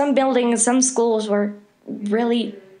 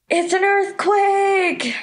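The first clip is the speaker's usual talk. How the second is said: high panicked mock yell of alarm; classmate's alarmed shriek, replayed high; retold scream; 9 semitones higher, slower than usual. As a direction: shout it like the ground just moved. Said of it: "one of my classmates screamed".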